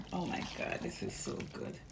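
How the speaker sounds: whispered delight at the first taste; whispering in delight over the food; awed whisper. Real, not acted. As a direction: breathe it out in a hushed whisper. Tasting own dish, awed.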